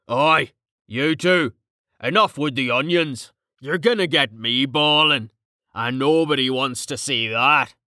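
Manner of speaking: colloquial, teasing big man; rough-and-ready joker. Acted. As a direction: break up the sentiment with blokey banter. Voices Carten breaking up the moment.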